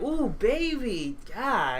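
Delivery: slow, high leering croon; creepy flirting.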